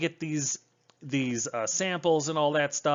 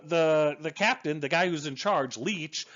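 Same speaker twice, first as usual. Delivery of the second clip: normal talking voice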